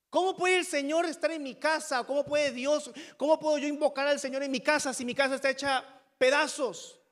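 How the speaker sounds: loud, high pleading protest; clamoring question. Acted. Preacher voices believer with broken home, clamoring question. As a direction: demand an answer from God, impassioned. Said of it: "clamado en forma de pregunta".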